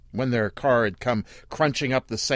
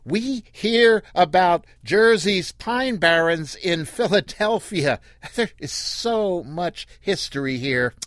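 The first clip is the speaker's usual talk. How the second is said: much higher, louder and slow; yelled slow; 8 semitones higher, 4 dB louder than usual. Acted. The woman yelling slowly at Earl about the Pine Barrens. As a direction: spell it out loudly to a foreigner. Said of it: "She yelled slow, like talking to".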